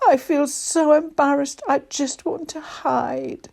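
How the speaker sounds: high elf wail, slowing at the end. A narrator's reading. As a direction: wail with embarrassment. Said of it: "Elf wailed".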